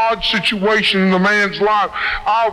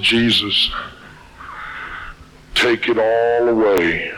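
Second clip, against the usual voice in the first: pleading prayer; 8 semitones lower than usual. Acted. Praying at the altar.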